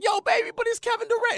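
very high, excited dad pleading; dad begging his daughter over the star; starstruck plea. Retold or imitated. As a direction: plead like a fan who can't believe it. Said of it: "I'm like".

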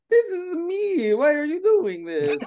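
high, slow mock wail; mock wounded Italian. Imagined Italian fan wailing at Mario, high and crying. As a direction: whine it like a hurt fan. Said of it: "Italians look at ... are like".

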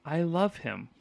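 musing Michael. Retold or imitated. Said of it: "he reflects on it himself".

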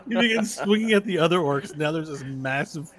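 normal talking voice

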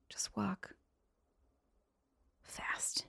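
slow whisper with pauses between words; whispered plea. Pretend. Repeats plea in a hush.

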